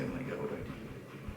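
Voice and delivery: very soft, half-whispered thinking; hushed retold panic; panicked inner voice. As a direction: think it under your breath. Retells panicked thinking to self.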